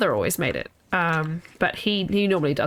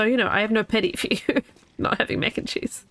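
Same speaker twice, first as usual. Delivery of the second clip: ordinary talk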